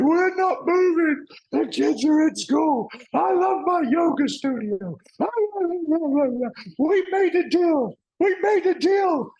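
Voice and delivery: very high, loud, drawn-out protest; hammed-up furious wife. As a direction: wail in outrage, mimicking an upset wife. Acted wife refusing, in tears.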